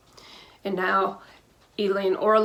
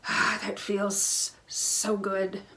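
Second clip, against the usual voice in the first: relieved sigh of relief; 6 dB quieter, much slower than usual. Real, not acted. After shared deep breath.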